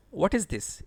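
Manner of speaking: ordinary talk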